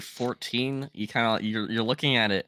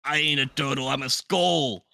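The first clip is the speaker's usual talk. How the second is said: gruff talking skull; 3 semitones higher than usual. Acted. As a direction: play a cranky talking skull.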